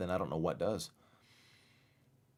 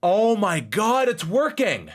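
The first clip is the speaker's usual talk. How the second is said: high, loud, amazed outburst; excited yelling; 10 semitones higher, 7 dB louder, much slower than usual. Real, not acted. Streamer amazed that the chat trick works, yelling excitedly. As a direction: yell it out, dumbstruck with excitement. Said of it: "Sorry if I'm yelling".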